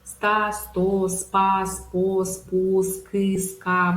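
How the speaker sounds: blended consonant clusters at the start, "ста, сто, спа", each syllable separate; teacher modelling "ста, сто, спу, ска" as merged syllables; syllable drill. Shown as a demonstration. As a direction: drill "ста, сто, спа, спо" evenly, the two consonants run together. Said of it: "когда два слога сливаются".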